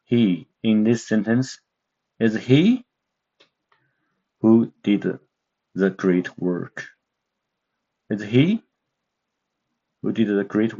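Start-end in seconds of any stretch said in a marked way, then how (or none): 2.2-2.8 "is he" sweeping up high on he; "is he" with a steep rise on he; rising tone. Shown as a demonstration. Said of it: "The second tone is a rising tone". 8.1-8.6 "Is he" climbing steeply on he; "Is he" with he gliding up; rising tone. Shown as a demonstration. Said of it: "this is a rising tone".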